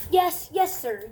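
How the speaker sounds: eager yes, loud and high; excited. Real, not acted. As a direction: blurt it out eagerly.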